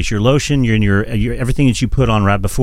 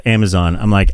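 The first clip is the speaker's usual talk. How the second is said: ordinary talk, nothing marked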